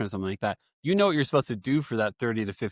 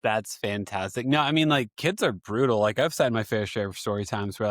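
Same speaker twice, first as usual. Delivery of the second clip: ordinary talk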